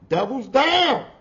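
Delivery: usual speaking voice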